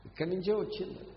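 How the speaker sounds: normal talking voice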